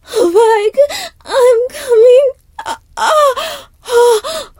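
high, loud climactic moans building to a peak; ecstatic cries. Acted. Lover reaching her peak, high loud ecstatic cries. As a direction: let the voice climb and crack as she peaks.

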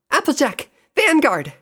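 high, loud calls to companions; shouting. A narrator's reading. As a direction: shout out each name. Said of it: "she shouted".

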